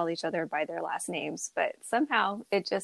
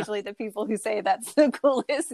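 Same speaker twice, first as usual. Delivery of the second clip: laughing; 3 semitones higher, 6 dB louder than usual. Real, not acted.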